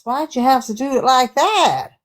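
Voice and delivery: high, incredulous comic rant; hammed-up indignant Aussie guest; ranting Karen. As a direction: pitch it up in mock outrage. Playing Karen, ranting about bills.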